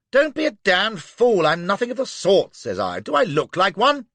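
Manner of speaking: high, loud indignant retort; loud protest. Acted. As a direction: fire it back indignantly. Flashman protesting loudly.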